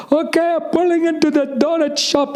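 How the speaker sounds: high, mock driver voice; driver's voice. Car service driver insisting on phone, high mock voice. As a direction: squeak it like the clueless driver.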